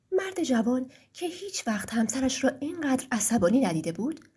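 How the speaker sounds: ordinary delivery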